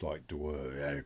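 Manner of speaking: low muttered mock Dwarvish; mumbling in Dwarvish. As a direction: mumble gibberish to yourself like a dwarf. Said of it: "Cadrak's mumbling to himself anyway".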